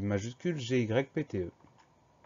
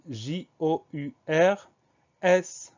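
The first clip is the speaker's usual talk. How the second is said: higher, slow, separated letters; slow spelling; 4 semitones higher, 3 dB louder, slower than usual. Shown as a demonstration. Teacher spelling the second syllable, slow higher-pitched spelling. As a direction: spell each letter slowly and apart.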